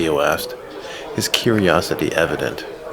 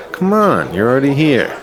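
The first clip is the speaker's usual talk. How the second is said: high, loud coaxing push; egging on; 8 semitones higher, 5 dB louder than usual. Acted. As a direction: coax him on, teasing pressure. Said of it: "egging him on".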